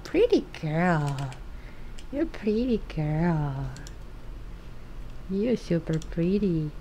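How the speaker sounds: tender cooing to the pet cat; soft cooing. Real, not acted.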